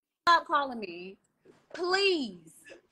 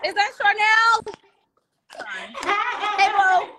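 loud, excited greeting shout; yelling; 6 semitones higher, 5 dB louder than usual. Real, not acted. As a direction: call out across the room happily. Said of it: "Why am I yelling?".

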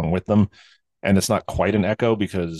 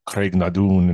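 Craignadoon with a rolled Scottish lilt, said a touch louder; a self-aware attempt at a Scottish Craignadoon; a playful, imperfect Scottish Craignadoon; Scottish accent; 4 dB louder, slower than usual. Shown as a demonstration. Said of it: "my Scottish accent isn't so great".

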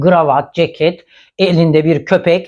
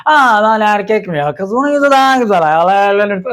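mock drunk woman; 2 semitones higher than usual. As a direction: mimic a tipsy guest interrupting. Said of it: "Aynen böyle sarhoş gibi". (sarhoşmuş gibi tiz, alaycı taklit)

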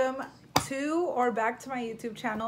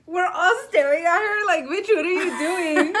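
speaking through laughter; giggling words out; laughing while talking; 7 semitones higher, 6 dB louder than usual. Real, not acted. Seen 0: Pink-shirt speaker laughs throughout, holding chip; not chewing then.

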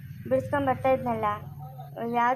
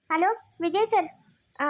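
ordinary talk

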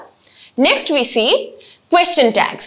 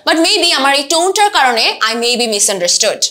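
usual speaking voice

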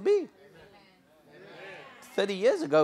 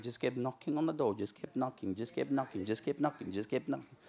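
fading repetition; 4 semitones lower, 8 dB quieter than usual. Acted. Repeated fading knocking.

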